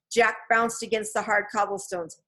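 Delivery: usual speaking voice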